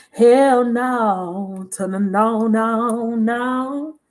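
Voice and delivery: long stretched-out words; sing-song, drawn-out rejection; playful emphatic refusal. Real, not acted. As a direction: draw out every no.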